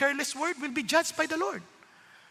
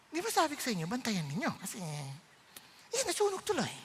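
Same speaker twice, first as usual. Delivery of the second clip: soft, slowed-down sweet scolding; suddenly controlled gentleness; 9 dB quieter than usual. Retold or imitated. Host scolding gently once Pastor arrives, suddenly controlled. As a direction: rein the temper in, suddenly hushed. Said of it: "bigla nakokontrol mo yung spirito".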